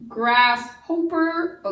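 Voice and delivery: GRASSHOPPER misread as grass-HOPE-er; unsure reader's GRASSHOPPER, hopper rhyming with roper; long O mispronunciation. Shown as a demonstration. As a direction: sound out GRASSHOPPER slowly with a long O. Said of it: "It can't be hoper".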